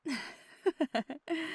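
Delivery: bursting into laughter. Acted. Teasing lover about last night, bursting into laughter. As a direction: break up laughing at your own tease.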